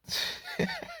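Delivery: short, soft, very high laugh; amused squeak. Real, not acted. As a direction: let out a squeaky chuckle.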